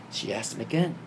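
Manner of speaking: ordinary talk, nothing marked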